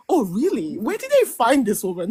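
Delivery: impressed surprise with big pitch swoops; retold amazement. Viewer recalling first sight of her on screen, amazed. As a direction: recreate being wowed at first sight.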